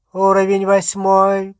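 high, even announcement, projected clearly; level, drawn tones of a lift voice; calm witch announcer. A narrator's reading. Lift voice calls out floors, calm witch announcer. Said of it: "произнёс спокойный голос колдуньи".

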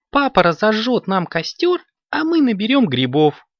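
put-on high-pitched child's voice; excited kitten. A narrator's reading. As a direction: lay out the plan high and eagerly. Kitten excitedly lays out her plan.

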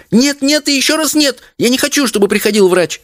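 put-on very high, insistent child's protest; crying out. A narrator's reading. Daughter refusing to have a doctor called, crying out. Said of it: "крикнула Леночка".

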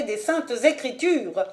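invoke scripture as final proof; emphatic authority. Acted. (ton haut, solennel et autoritaire)

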